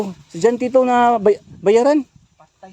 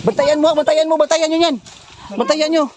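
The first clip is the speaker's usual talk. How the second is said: shouted command; 8 semitones higher, 5 dB louder than usual. Acted. Ordering others to guard him.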